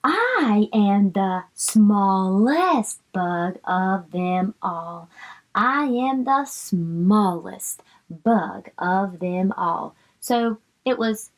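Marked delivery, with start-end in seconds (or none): 0.0-5.1 drawn out sounded-out words; slow reading for kids. Shown as a demonstration. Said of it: "bug reading line". 5.5-9.9 drawn out sounded-out words; slow reading for kids. Shown as a demonstration. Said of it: "bug reading line".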